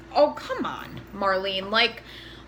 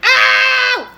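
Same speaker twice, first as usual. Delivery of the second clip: high, loud yelps of "Ow!"; mock pained yells; 12 semitones higher, 12 dB louder, much slower than usual. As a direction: yell each "Ow!" like a hammed-up injury. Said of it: "I'm like".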